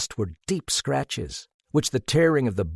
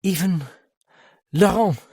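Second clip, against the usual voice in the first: hesitant; 7 semitones higher, 5 dB louder than usual. A narrator's reading.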